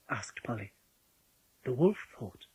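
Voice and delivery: ordinary delivery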